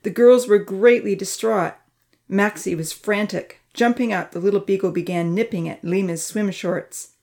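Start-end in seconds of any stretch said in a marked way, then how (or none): none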